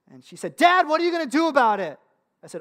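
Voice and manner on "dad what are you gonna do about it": high, loud mock demand; indignant little-girl challenge, acted out; demanding child. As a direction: imitate an exasperated kid calling Dad out. Imitates frustrated daughter confronting dad.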